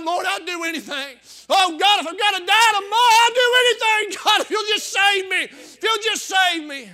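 high, loud pleading shout; crying out to God. Retold or imitated. Preacher retelling his altar prayer, pleading shout to God. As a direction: beg God at the top of your voice. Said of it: "I cried out to God".

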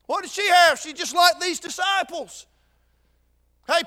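usual speaking voice

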